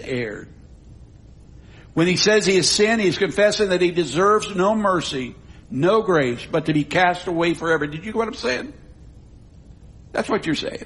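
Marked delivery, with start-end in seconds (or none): none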